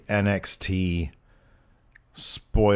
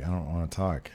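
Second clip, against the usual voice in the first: mumbled; 4 semitones lower, 10 dB quieter than usual. Retold or imitated. Reluctant reply to barber.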